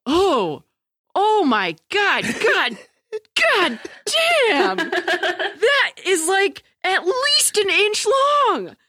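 high, escalating amazement breaking into laughter; mock freak-out. Imitating a friend's reaction to the removal, mock freak-out. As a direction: act out a horrified, drawn-out gawp. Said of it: "your reaction. No, it was like".

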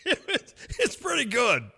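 high-pitched, laughing through the words; real laughter. Real, not acted. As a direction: get it out while cracking up. Cracking up after own imitation.

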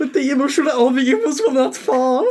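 high mock-German voice breaking with laughter; German accent. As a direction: play a panicking German soldier while laughing. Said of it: "I'm doing a German accent".

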